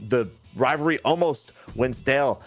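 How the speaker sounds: usual speaking voice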